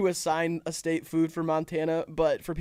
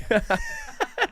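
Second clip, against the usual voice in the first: squealing laugh jumping very high. Real, not acted. Laughs at pee-pee joke.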